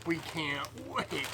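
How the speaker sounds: ordinary delivery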